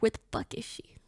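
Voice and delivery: whispered, breathless panic; panicked whisper. Retold or imitated. Owner searching for her lost cat, whispering. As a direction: hiss it under your breath.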